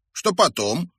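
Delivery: high, inquiring voice; curious question. A narrator's reading. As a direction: ask with keen interest. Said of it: "поинтересовался мальчик".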